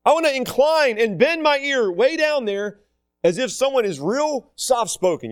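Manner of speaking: sincere, slow prayer; earnest prayer. Acted. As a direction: lean in and plead gently. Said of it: "We gotta focus and say".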